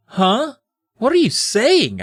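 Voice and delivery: high, drawn-out disbelief; bewildered cry. Acted. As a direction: cry out in hammed-up confusion. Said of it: "I cried".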